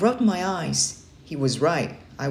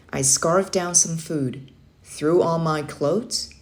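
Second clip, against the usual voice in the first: plain reading voice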